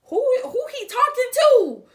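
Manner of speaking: high, loud, incredulous outburst; outraged shout. Acted. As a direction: yell it in shocked disbelief. Reacting outraged at his outburst.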